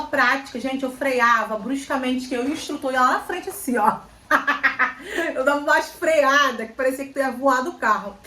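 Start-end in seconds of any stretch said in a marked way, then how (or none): 4.3-5.3 a quick real laugh, pitched up; bright burst of laughter. Real, not acted. Laughing at her own harsh braking in driving class. Recalling braking too hard. 5.3-8.2 laughing. Real, not acted. Laughing about harsh braking.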